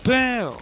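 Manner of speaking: high, falling stinger sound; imitated musical stinger. Describing the scary ending, high imitated stinger. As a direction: mimic the sudden high sting. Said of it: "end with the stinger".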